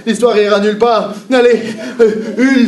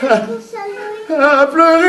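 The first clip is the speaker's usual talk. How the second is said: mock crying; 12 semitones higher, slower than usual. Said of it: "je me suis mis à pleurer".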